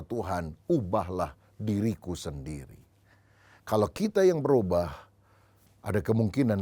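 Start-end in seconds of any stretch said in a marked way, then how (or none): 0.0-2.8 hushed, slow, quieter prayer; soft humble prayer. Retold or imitated. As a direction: pray it softly and slowly, humbled. Quoted humble prayer of the sage. (doa lirih dan pelan)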